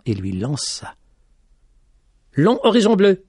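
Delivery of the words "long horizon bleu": bold, loud and high call; striking exclamation. A narrator's reading. Young man calls out boldly to the blind lady, striking. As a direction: fling the words out to catch her. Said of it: "d'un air saisissant".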